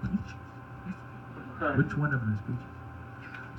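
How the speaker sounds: very soft, slow, puzzled murmur; mouthing the words. Retold or imitated. Onlookers wondering who has the nickname, barely voiced. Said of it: "people mouthing the words going".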